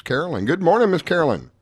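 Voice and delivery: ordinary talk, nothing marked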